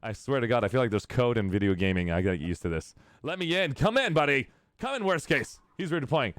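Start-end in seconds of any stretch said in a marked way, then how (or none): 3.2-6.3 high-pitched, excited calls with laughter creeping in; playful calling out. Pretend. Streamer calling a buddy into chat, playfully excited. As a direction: whoop it up as you call a buddy over.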